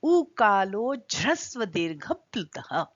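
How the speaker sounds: slow Sanskrit recitation; measured chanting of a Panini sutra. Shown as a demonstration. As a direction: recite the sutra slowly and evenly. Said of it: "एक बहुत अच्छा सूत्र बताया है".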